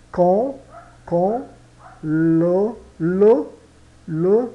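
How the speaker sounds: "quand" e "le" ditos alto e separados; stressed model. Shown as a demonstration. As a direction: martele "quand, le" para a turma. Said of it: "O R bem puxado". (professora repete "quand le" forte e lento)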